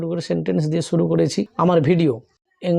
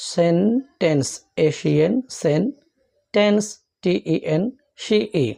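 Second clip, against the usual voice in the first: সেন and tense split with letters named; teacher dividing sentence; spelling out syllables. Shown as a demonstration. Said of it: "এইভাবে আমরা ... ভাগ করে নিলাম".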